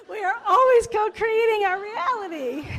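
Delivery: high, loud, words squeezed through laughter; real laughter over the moral; laughing hard. Real, not acted. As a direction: speak while cracking up.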